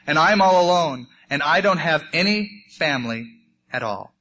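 plain reading voice

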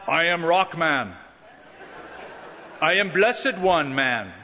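mock-heroic, deliberate boast; self-confident. Peter pulling out the sword, self-confident. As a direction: announce yourself like a proud champion. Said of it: "Self-confidence".

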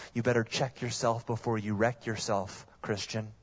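ordinary delivery

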